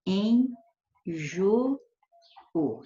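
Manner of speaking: "em jo-, o" with a clear pause between each syllable; slow syllable-by-syllable "em... jo... o"; syllables separated. Shown as a demonstration. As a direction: break "em jo-, o" apart, each "o" in its own syllable. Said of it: "Eu já separei assim".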